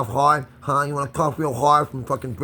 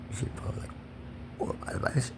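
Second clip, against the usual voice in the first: whisper; 2 semitones higher, 13 dB quieter than usual. Real, not acted. Whispered advice.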